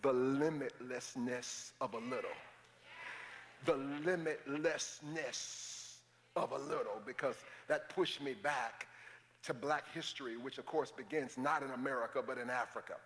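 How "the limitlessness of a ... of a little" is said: limitlessness of a little said slowly and weighty; slow emphasis. Shown as a demonstration. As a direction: savor the phrase limitlessness of a little. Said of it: "this term jumped in my mind".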